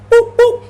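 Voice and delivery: high loud boop boop punch noises; mock punch sounds. Retelling the fight, imitating punches landing, high and loud. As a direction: smack it out like cartoon hits.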